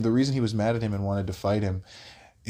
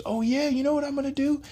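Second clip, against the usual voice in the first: very high-pitched imitated taunt; mock screaming. Crackhead taunting the husband before charging, high mock scream. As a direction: squeal it like a ranting man, volume held back. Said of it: "he starts screaming out of the car".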